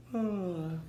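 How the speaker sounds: soft, high weary sigh; bored sigh. Acted. Bored prince yawning.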